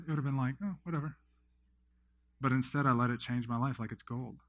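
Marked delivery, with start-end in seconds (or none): 0.6-1.2 higher-pitched offhand brush-off; dismissive. Acted. Imagining himself not valuing a prophetic word, dismissive. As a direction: shrug it off lightly, pitched up. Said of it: "didn't put any emphasis on that word".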